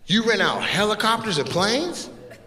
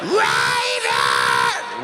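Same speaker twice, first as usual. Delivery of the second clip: distant shout; much slower than usual. Retold or imitated. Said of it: "She's like".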